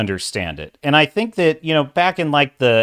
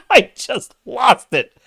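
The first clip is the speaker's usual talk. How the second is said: laughing; 10 semitones higher, 4 dB louder, slower than usual. Real, not acted. Still laughing.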